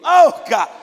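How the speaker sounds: shouted, high, slowing on each promise; whooping gospel preacher climax; full-force preaching. Real, not acted. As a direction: preach it out at the top of the voice.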